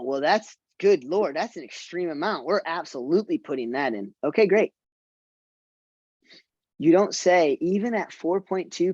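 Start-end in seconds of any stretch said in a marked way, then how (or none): 4.2-4.7 quick easy agreement. Shown as a demonstration. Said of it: "You just say".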